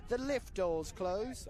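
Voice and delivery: hammed-up British accent, drawn out slowly; mock British voice for viewers across the pond; bad British accent. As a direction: put on a terrible, slow British accent. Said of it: "that was the worst accent ever".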